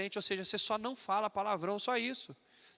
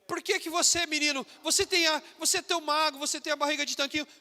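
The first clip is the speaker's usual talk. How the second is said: high, jeering schoolyard taunt, mildly hammed; a light imitation of mocking teens; mocking classmates; 7 semitones higher, 5 dB louder than usual. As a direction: sneer at the believer's clothes like a teasing kid. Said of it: "Vão começar a chacotear".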